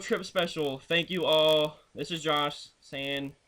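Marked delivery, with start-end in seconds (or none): none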